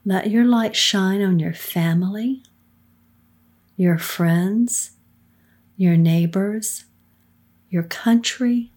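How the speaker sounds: normal talking voice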